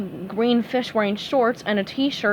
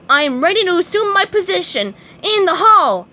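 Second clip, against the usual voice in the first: pompous eager; 8 semitones higher, 7 dB louder than usual. A narrator's reading. SpongeBob pompous duty.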